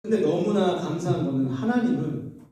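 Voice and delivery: usual speaking voice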